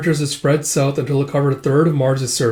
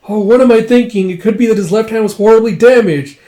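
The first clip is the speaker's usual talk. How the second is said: higher, louder, lightly acted self-correction; agitated realization; 8 semitones higher, 7 dB louder than usual. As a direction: act out a worked-up realization. Character excitedly reasons out a discovery.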